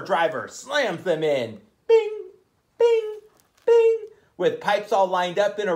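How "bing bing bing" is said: high bing sound effect. Acted. Sound effect of power driver.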